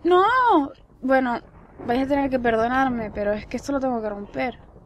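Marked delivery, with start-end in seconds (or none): 0.0-0.7 high, loud no; dismayed cry. Real, not acted. Player failing the attempt at the painting, dismayed cry. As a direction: cry out at the failure. Failed jump in game.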